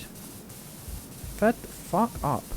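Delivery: slow unvoiced whisper; whispered exasperation. Real, not acted. Streamer muttering to himself, whispered and slow. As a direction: breathe it out fed up.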